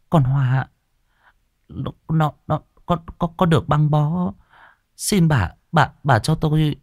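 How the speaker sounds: put-on old cook stammering a plea to her mistress; timid stammer. A narrator's reading. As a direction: beg while tripping over the words. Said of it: "bà bếp Năm rụt rè".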